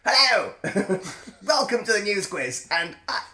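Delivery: high, loud posh English impression. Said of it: "that's how you should do his voice".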